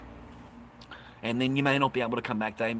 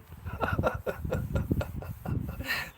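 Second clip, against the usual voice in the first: long, high wheezy laughing fit; real amused laughter. Real, not acted. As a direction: crack up at a viewer's joke. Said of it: "Thanks for making me laugh".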